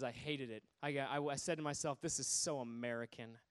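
low, soft grumble; retold disdain. Young British person confiding to the preacher, low disdain. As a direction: drop low and quiet, as the sceptic confessing.

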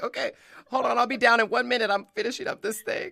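mock stalling. Host stalling her family from the hotel, high and playful. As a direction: call down the stairs to buy time.

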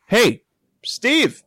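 high, punchy shout to someone across the way; imitated call. Storyteller imitating a voice from the tennis court, calling out.

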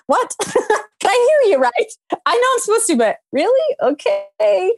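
high-pitched disbelief between laughs; incredulous reaction. Retold or imitated. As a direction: question it in a squeaky, reluctant high voice. Acting out her startled reply to God.

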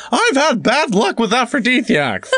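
character voice. Retold or imitated. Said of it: "chimes out".

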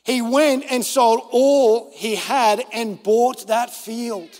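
slow, weighty reading of the parable; scripture reading. Acted. Preacher reading the parable to his listeners, slow. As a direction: let each phrase of the verse land, slowly.

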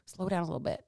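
soft, easing-off coach voice; hushed, calming self-correction; pulling it back. Acted. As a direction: drop the volume and gently rein them in. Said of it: "I had to pull it back".

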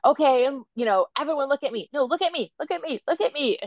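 high, insistent mock pleading for attention; acted impatient parent. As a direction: mimic a harried parent herding kids for a photo. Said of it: "I could totally get like that too".

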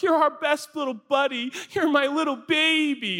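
high cooing dog-owner voice; doting, high baby-talk; baby talk to pet. Acted. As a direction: gush sweetly at your puppy, high-pitched. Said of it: "But I'm like".

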